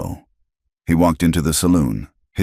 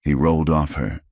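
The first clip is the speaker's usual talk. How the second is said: usual speaking voice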